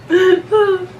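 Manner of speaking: teary laugh, eyes watering; laughing through spicy tears; crying from spice. Real, not acted. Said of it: "Here we go, crying again".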